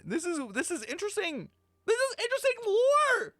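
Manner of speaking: rising to a high, excited pitch. Real, not acted. Streamer thrilled by the lore, high excited voice. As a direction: gush that this is great.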